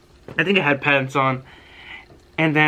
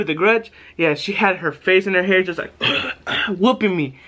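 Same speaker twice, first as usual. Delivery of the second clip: usual speaking voice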